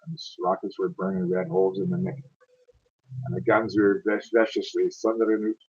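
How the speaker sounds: rolling, dramatic ballad reading; a Scots war poem read aloud with feeling; poem recitation. Acted.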